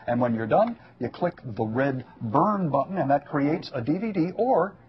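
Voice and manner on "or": ordinary talk, nothing marked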